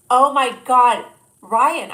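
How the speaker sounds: loud, high delighted squeal at the line; excited outburst. Real, not acted. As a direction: burst out in delight. Reacting to pickup line.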